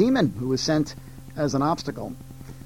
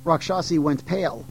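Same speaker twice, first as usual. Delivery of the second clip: plain reading voice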